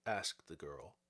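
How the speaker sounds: ordinary delivery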